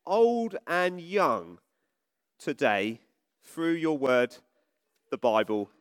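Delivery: slow, hushed opening prayer. Acted. Vicar opening the children's talk, praying. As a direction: pray over the congregation, slowly.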